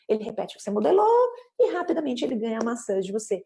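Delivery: ordinary talk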